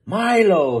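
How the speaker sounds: loud sharp bark of a name. A narrator's reading. Angry manager barks at the magician. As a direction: bark his name at him. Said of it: "he barked".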